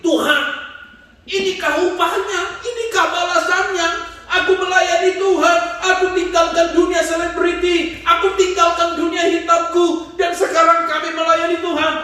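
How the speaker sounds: high, loud, pleading cry to God; anguished outcry. Retold or imitated. Grieving father crying out to God, loud and anguished. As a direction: wail the complaint up at heaven.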